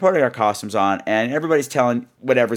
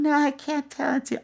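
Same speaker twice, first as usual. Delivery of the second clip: put-on little girl voice; 12 semitones higher, 6 dB quieter than usual. [name] greets Mister Plummet, a high little-girl voice. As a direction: chirp it like a six-year-old girl.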